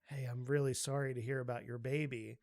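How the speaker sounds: low, soft, confiding apology; mock condolence. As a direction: pull someone aside and offer sympathy quietly. Retold friend's hushed condolence.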